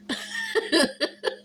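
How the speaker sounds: soft airy laugh; quiet unvoiced chuckle; breathy giggle. Real, not acted. Laughing after winter line.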